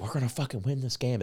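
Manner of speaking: high, fast hyped-up certainty; high fast fan boast; excited. Retold or imitated. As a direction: pump yourself up, sure of the win. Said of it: "I'm all excited".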